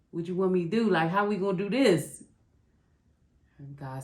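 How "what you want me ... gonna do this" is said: pleading up and out, louder and higher; louder desperate plea. Retold or imitated. Arguing with God about her son, louder and higher. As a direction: push the question at God, louder. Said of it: "using our son as an excuse".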